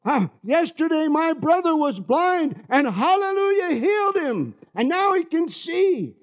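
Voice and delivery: high-pitched, jubilant testimony; imitated excited boy. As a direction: play a boy bursting with good news. Healed man's young brother explains joyfully.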